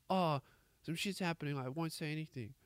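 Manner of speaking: soft, hushed mumble to oneself; muttered avoidance. Acted. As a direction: play it as a meek, quiet aside. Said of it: "we just all ignore it".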